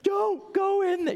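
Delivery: mock movie scream. Moviegoer shrieking at a horror film character, mock panic. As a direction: yell it like a scared moviegoer.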